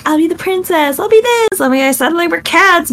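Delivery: put-on high, eager little girl claiming roles; excited kid. Voicing herself as a child with cousins, high eager kid voice. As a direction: gush like a kid at pretend play. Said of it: "you know, like".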